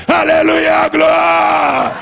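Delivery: high, sustained chanted shout; praise cry. Real, not acted. Preacher leading the cry of the saved, chanted shout. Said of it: "c'est le cri des sauvés".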